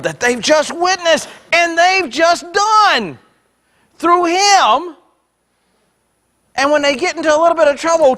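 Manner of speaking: high, driving, impassioned pulpit voice; full-force preaching. Real, not acted. Preacher telling folks we do this in our lives, impassioned preaching. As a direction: press each phrase home with rising fervour.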